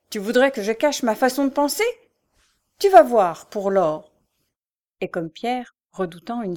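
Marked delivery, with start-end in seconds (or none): 0.1-4.1 loud, higher threatening outburst; angry threat. A narrator's reading. Mother threatens her son, loud and furious. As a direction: shout the threat in his face. The beggar woman keeps raging.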